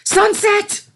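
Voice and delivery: high, loud cry of alarm; alarmed shout. A narrator's reading. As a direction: shout her name in panic. Celestia sees filly sinking.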